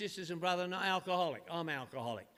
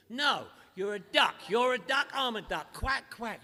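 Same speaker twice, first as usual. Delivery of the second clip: louder, pushy mock lecture; insistent old-timer; 3 semitones higher, 7 dB louder than usual. Old-timer telling the newcomer he is a duck, loud and insistent. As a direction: hammer it home like a gruff sponsor.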